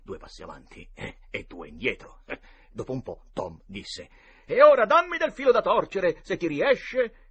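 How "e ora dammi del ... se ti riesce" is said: duelling taunt. A narrator's reading. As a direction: dare your opponent at full voice. Boy taunts duelling opponent. (provocazione da duello, a gran voce)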